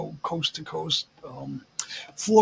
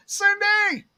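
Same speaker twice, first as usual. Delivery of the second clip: high, slowing mock call to his wife; imitating a man calling through the house; brother-in-law calling out; 13 semitones higher, slower than usual. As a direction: sing it out high, teasing. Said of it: "her husband answered the phone, and he goes".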